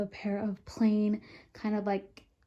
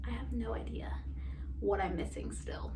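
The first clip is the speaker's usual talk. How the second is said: soft unvoiced whisper; 7 dB quieter than usual. Real, not acted. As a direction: breathe it out as a whisper. Whispered aside about packing.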